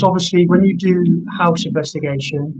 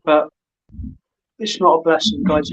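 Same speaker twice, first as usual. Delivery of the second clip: ordinary talk, nothing marked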